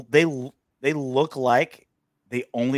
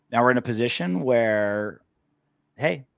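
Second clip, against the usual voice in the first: ordinary talk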